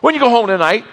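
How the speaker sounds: high, mock-calming buddy voice; lightly voiced coworker calming him; soothing coworker. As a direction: talk him down like a pal. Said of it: "the guy beside him says".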